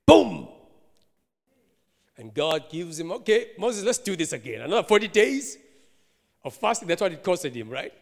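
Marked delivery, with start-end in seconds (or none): 0.0-0.5 loud, sudden boom; imitated crash. Preacher acts out Moses snapping, with an explosive boom. 3.1-6.9 tag. Acted. Voices God giving Moses another chance.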